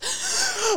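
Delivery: a sharp, high gasping sob; sobbing gasp. Retold or imitated. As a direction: gulp in air mid-sob. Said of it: "between sobs".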